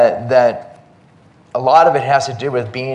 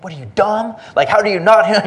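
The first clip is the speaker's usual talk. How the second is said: high, exasperated imitation of a scolder; mock angry yelling; 9 semitones higher, 4 dB louder than usual. Imagined train lady berating a clueless passenger, mock angry. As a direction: scold in a raised, high pitch. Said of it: "They just screaming and yelling".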